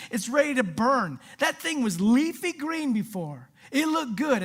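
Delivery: high-pitched excited exclaiming; amazed outburst. Acted. Peter showing Jesus the withered fig tree, amazed outburst.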